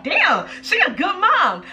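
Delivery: fake-impressed, drawn out; sarcastic praise. Sarcastic take on her posts.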